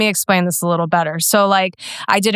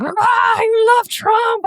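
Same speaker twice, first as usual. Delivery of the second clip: much higher, slow drawn-out mock outrage; mock shrieking critic; much slower than usual. Mocking swarming critics online, hammed-up high slow shriek. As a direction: shriek it slowly like a hysterical troll.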